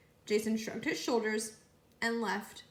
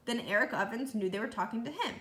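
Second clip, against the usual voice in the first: plain reading voice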